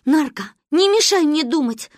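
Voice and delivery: high, loud, cross retort; angry. A narrator's reading. Girl thinking hard rebukes her friend, angry. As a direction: snap crossly at an interrupting friend. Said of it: "рассердилась Маня".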